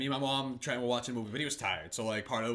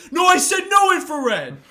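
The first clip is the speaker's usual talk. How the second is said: high, loud mock protest; mock outrage; 12 semitones higher, 9 dB louder than usual. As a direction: shout an objection as if cheated at a game. Acting out outraged hide-and-seek player.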